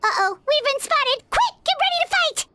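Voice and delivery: high-pitched alarmed warning, rising into a cry; urgent alarm. Acted. Companion warning the Traveler of monsters, urgent alarm. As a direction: sound the alarm, pitch jumping up.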